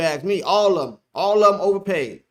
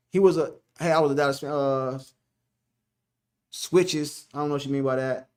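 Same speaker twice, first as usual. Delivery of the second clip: usual speaking voice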